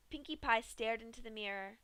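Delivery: ordinary delivery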